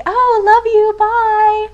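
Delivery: casual love you. Retold or imitated. American girl ending a phone call, breezy. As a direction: chirp love you like it's nothing. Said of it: "We'll just be like".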